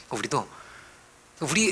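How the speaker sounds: ordinary talk, nothing marked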